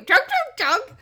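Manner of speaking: loud, high squawked jugs; mock bird call. Co-host mimics the nightingale, loud high bird call. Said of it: "What kind of bird are you?".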